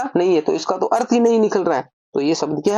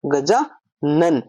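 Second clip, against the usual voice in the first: Gajanan drawn out very slowly as the answer; slow emphatic answer; 4 semitones lower, much slower than usual. Shown as a demonstration. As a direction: present the word Gajanan slowly, for the class to copy. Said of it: "शब्द क्या बन गया". (धीरे-धीरे, ज़ोर देकर गजानन)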